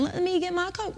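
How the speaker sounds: high, soft English granny voice; grandmother's English accent. Retold or imitated. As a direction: imitate an old English lady's little accent. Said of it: "with her little accent".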